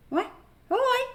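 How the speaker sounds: high, loud excited "ouais"; high-pitched eager agreement; squealed yes. Real, not acted. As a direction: yelp it out in delight.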